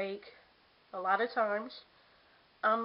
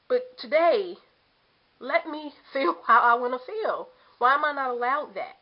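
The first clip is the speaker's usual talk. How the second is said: louder, higher, exasperated plea; frustrated protest; 4 semitones higher, 7 dB louder than usual. Real, not acted. Protesting she may feel frustrated.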